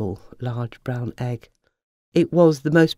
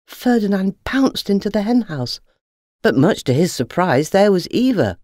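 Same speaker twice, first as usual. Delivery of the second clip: ordinary delivery, nothing marked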